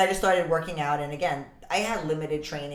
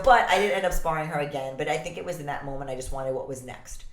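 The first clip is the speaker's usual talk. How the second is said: ordinary talk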